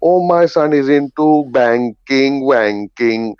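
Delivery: imitated auntie, slow singsong boast; proud Indian auntie. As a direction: draw out the rhyming words like a proud auntie. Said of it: "she said it at the top of her voice".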